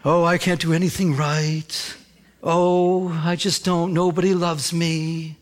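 mock self-pitying moan; drawn-out, woeful whine of false humility. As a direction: play someone wallowing in self-pity. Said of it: "some people are like".

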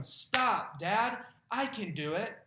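put-on loud toddler protest; toddler insisting. Toddler refusing his dad's help, loud protest.

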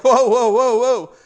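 loud, high startled backing-off; mock alarm. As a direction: act out a freaked-out bystander, loudly. Acting most people's alarmed reaction.

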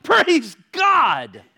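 hammed-up, loud and high "Praise God" through pain; mock cheerful praise. Preacher mocking a giddy believer, loud mock praise. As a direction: act out a forced hallelujah after a slammed hand. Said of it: "you're like".

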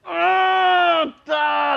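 very high loud slow yell; mock shout at Tesla drivers. Pastor retells shouting at Tesla drivers from his car, high and loud. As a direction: bellow at the arrogant drivers. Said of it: "I was like".

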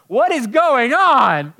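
high, loud and slow disbelief; overwhelmed, voice rising; shocked slow cry. Retold or imitated. Overwhelmed by a weeping pastor, loud slow disbelief. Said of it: "And I'm like".

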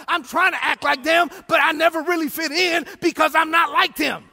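loud, shouting sermon delivery, big swells on key lines; preaching at full force. Acted. Pastor exhorting the congregation and online viewers, shouting preacher. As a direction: preach it like a revival pastor calling his church home.

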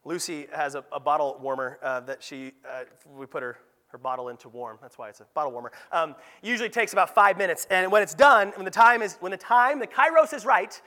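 ordinary talk

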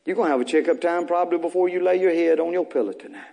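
ordinary talk, nothing marked

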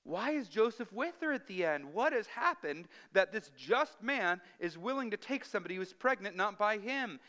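high, urgent, baffled questions; astonished questioning. Acted. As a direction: act out a gripped listener demanding answers. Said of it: "sets you up to be".

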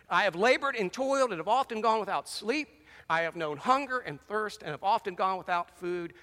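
fiery sermon reading of Paul's sufferings; scripture reading. Acted. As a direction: preach Paul's boast with mounting force.

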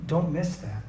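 ordinary talk, nothing marked

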